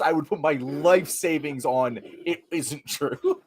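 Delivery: ordinary delivery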